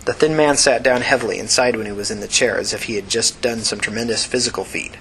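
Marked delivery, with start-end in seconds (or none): none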